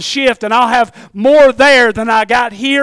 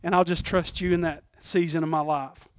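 soft prayer; 5 semitones lower, 9 dB quieter than usual. Pretend. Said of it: "I like to say this".

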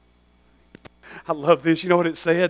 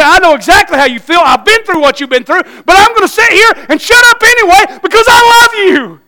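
louder, higher rising to much higher; preacher voicing a friend, growing fervent; fervent empathy; 11 semitones higher, 10 dB louder than usual. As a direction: build it up with feeling. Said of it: "You can say".

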